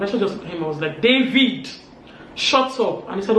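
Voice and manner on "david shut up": higher, louder command; sharp silencing. Retold or imitated. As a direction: cut him off with a firm reprimand. Retold silencing of David.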